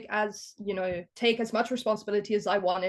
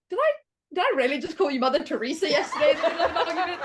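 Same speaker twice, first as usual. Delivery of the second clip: rushed, higher, laughing through it; laughing disbelief; 5 semitones higher, 3 dB louder, much faster than usual. Retold or imitated. Daughter asking her mom the next day, fast and laughing. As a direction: laugh at your own absurd words.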